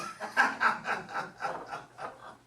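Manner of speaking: put-on breathy jeering laugh in the high teen voice; scornful schoolyard snicker; mocking teen laugh. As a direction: laugh at the old man. Boys mock the old man.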